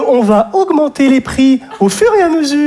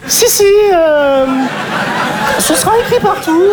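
laughing employee; 5 semitones higher than usual. Acted.

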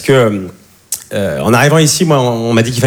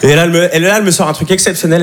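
usual speaking voice

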